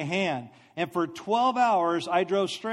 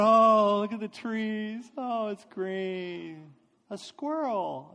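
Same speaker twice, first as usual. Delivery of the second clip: drugged happy; 3 semitones higher, slower than usual. Retold or imitated. Driver on Ativan admiring the roadside, acting dreamily happy. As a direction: marvel dreamily, drawing each word out. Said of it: "I'm, like, so happy".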